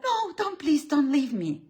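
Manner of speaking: high, mock desperate begging; pleading. A woman begging her man not to leave, mock pleading. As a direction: cry out like you're clinging on. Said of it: "and you're like".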